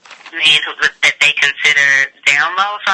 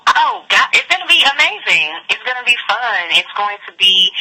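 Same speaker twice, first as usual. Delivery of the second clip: excited; 6 semitones higher than usual. Real, not acted. Excited pitch about the conference.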